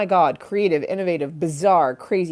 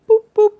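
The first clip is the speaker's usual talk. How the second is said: much higher squeaky boop boop; 11 dB louder than usual. Pretend. Streamer adding a playful high boop effect. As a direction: chirp it like a cartoon button.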